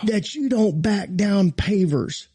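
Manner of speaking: high, slow scornful yell; contemptuous mock yell. Groundsman insulting the pastor, mock contempt. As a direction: sneer it like the angry groundsman. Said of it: "He said".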